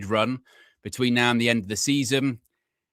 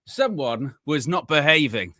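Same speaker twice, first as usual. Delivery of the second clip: usual speaking voice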